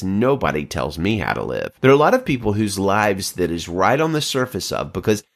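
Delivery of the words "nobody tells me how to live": low, stubborn inner voice; a hint of a defiant, self-absorbed person; low self-centred voice. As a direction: mutter it low like the selfish heart talking. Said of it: "of that self-absorption that says".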